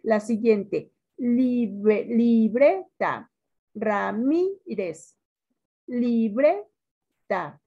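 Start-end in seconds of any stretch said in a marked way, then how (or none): 1.2-3.3 "libreta" broken up slowly, BRE loud; stress on BRE. Shown as a demonstration. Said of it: "Sílaba tónica". 3.8-5.2 "Ramírez" very slow, MI stretched and raised; stress on MI. Shown as a demonstration. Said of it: "Sílaba tónica". 5.9-7.6 "Libreta" very slow in separate syllables, BRE stretched; stress on BRE. Shown as a demonstration. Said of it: "Sílaba tónica".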